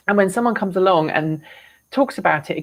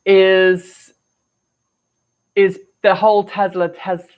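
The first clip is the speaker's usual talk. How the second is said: ordinary talk, nothing marked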